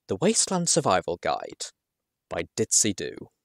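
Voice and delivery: ordinary delivery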